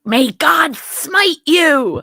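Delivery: slow, high, solemn curse voice; laying a curse. Retold or imitated. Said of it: "And they're like".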